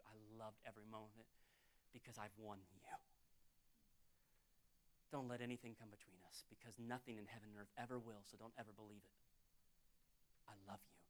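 very soft, low declaration of love; low, near-whispered devotion with long pauses; tender God voice. Acted. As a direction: speak hushed and intimate to your bride. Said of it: "He's looking and going".

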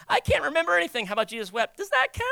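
high-pitched mock pleading; squeaky, hopeful excuse-making; hapless young memorizer. Acted young memorizer pleading for credit.

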